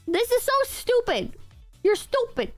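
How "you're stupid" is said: usual speaking voice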